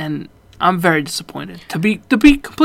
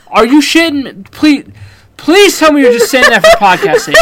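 loud, higher, laughing through the words; laughing disbelief; 7 semitones higher, 8 dB louder than usual. Real, not acted. Host begs Corey through laughter to say it was a joke. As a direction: plead through your own laughter.

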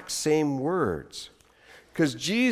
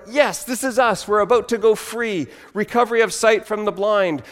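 higher, eager exclamation; eager and raised; very excited crowd; 7 semitones higher, 3 dB louder than usual. Acted. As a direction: voice a crowd thrilled at its freedom. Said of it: "So they get very excited".

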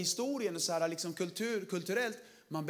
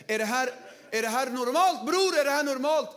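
mock indignant asking; 5 semitones higher, 6 dB louder than usual. Mock shocked question.

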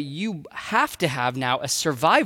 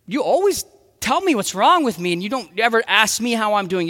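high, loud escalating retort; angry spouse fighting back; 4 semitones higher, 6 dB louder than usual. Acted. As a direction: hit back harder and harder. Other spouse fires back.

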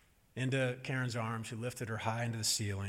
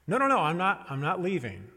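higher, louder burst of hurried reassurance; urgent reassurance; 6 semitones higher, 6 dB louder than usual. Retold or imitated. Mother to toddler in the far corner, urgent reassurance. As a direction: imitate a mother heading off a toddler's panic.

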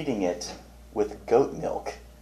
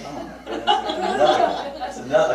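high, breathy laughing oh my goodness; giggling at another bunny in the yard; laughing disbelief; 13 semitones higher, 5 dB louder than usual. Retold or imitated. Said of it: "it's like".

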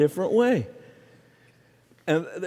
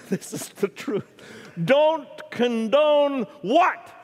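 imitating his wife; 8 semitones higher, slower than usual. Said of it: "And she said".

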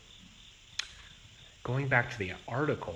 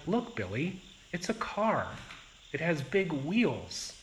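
put-on higher, brighter voice of a mother teaching her toddler; 6 semitones higher, 3 dB louder than usual. American mother showing her toddler a toy car, higher voice. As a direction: point things out to a little kid, pitched up. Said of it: "would say something like".

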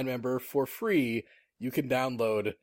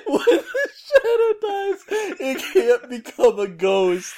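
slow, louder, through laughter; quoting it while cracking up; laughing mock narration; 14 semitones higher, 10 dB louder than usual. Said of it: "quoting trailer narration, laughing".

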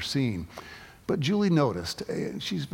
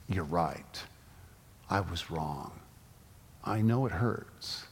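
soft apology; 7 semitones lower, 8 dB quieter, slower than usual. Retold or imitated.

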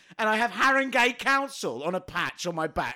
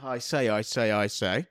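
put-on old comedian voice; 9 semitones lower than usual. Old music-hall comedian opener.

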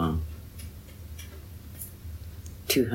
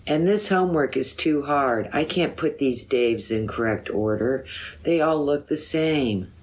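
a raised, higher yell from June; 6 semitones higher than usual. A narrator's reading. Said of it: "So June yelled".

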